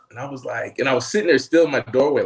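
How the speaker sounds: ordinary talk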